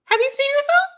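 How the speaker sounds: high, sharp disbelief; mock outraged high squeal; incredulous squeal. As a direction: shriek it like you can't believe them. Mocking retort.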